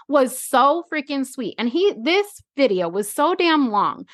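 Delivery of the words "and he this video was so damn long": ordinary talk, nothing marked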